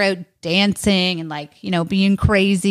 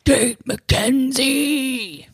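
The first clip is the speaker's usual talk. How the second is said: grand announcement; 5 semitones higher, much slower than usual. Acted. Grand final name announcement.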